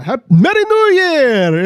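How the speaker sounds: high, drawn-out festive cry; hammed-up imitation of a jolly foreign traveler; exuberant movie greeting. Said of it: "he comes in and he's like".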